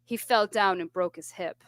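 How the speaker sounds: low, toneless retold deadpan; imitating someone utterly unmoved. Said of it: "deadpan, she goes".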